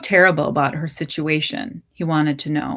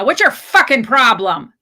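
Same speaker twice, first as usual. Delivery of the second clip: loud, higher furious shout; angrier yell; 5 semitones higher, 6 dB louder than usual. Acted. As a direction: spit it out in rage. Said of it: "angrier now".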